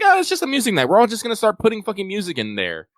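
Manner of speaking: high, loud excited mimicry; excited quote. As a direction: gush like the whole group. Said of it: "everyone's just like".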